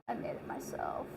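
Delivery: breathy whispered boast. Real, not acted. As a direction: drop to a whisper, joking. Whispered joke.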